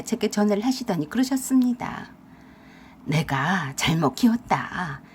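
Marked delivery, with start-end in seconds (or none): 3.0-5.0 much lower, slow rueful father; father-in-law. Acted. Father-in-law apologising to daughter-in-law, low and slow.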